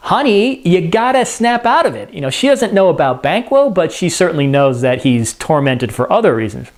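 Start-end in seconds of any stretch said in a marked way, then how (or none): 0.1-2.1 higher-pitched, lightly acted urging; wife trying to snap her husband out of it; urgent wifely scolding. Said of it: "she rushes over to him".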